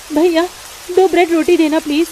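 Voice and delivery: mother asking the shopkeeper for bread; lightly voiced polite mother. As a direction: ask the shopkeeper nicely.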